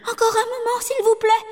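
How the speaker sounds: very high pleading; terrified wife begging a moment; fearful plea. A narrator's reading. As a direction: stall him, frightened.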